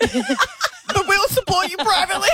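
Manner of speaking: slow, teasing line through a laugh; joking, laughing. Real, not acted. Co-host teasing the departing producer, laughing and slow. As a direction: deliver the punchline slowly with a chuckle.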